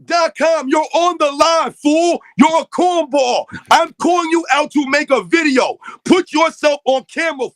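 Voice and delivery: loud, slow, angry callout at a chatter; angry shout. Real, not acted. Host shouting down a chatter in the live chat, angry yell. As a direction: bark it out at him, word by word.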